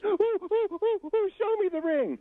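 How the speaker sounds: squealing falsetto cooing; mock-excited high female voice; excited girlfriends. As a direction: mimic a gaggle of thrilled girlfriends, very high. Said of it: "And they say".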